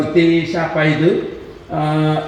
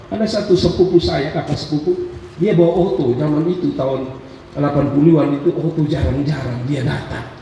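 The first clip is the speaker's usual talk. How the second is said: ordinary talk, nothing marked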